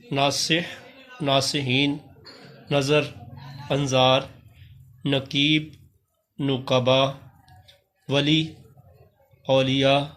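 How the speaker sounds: slow, clear reading of each Urdu word with pauses; careful word drill. Shown as a demonstration. Teacher reading plural forms to class, careful drill. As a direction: read each word crisply for students to copy.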